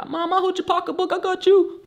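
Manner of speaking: put-on high sweet kid offering to help his mom; little kid voice. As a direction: play yourself as a small helpful boy. Voicing his child self.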